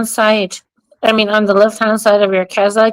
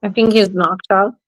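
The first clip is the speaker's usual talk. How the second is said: normal talking voice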